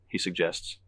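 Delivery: ordinary delivery, nothing marked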